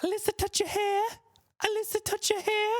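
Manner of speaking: put-on very high, soft childlike squeak; imitating Alyssa. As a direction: mimic the girl in a tiny high voice. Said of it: "and she'd go".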